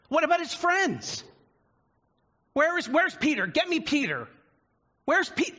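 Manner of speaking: rising panic and anger, shouting at the crowd; freaking out. Retold or imitated. Richard Pryor voiced by preacher, high frantic angry shouting. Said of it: "Richard Pryor starts freaking out".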